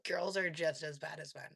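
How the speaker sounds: ordinary talk, nothing marked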